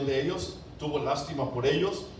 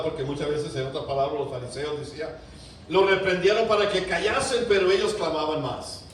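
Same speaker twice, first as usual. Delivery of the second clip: ordinary delivery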